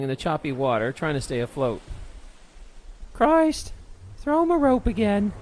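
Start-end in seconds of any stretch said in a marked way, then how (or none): 3.1-5.3 high, raised captain's command; exasperated order. A narrator's reading. Captain has the crew rescue him once more, ordering. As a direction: call it out sharply, pitched high. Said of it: "she ordered".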